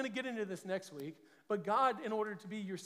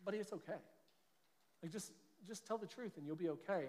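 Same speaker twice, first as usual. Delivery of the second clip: soft reassuring parent; 7 dB quieter than usual. Retold or imitated. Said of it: "I was like".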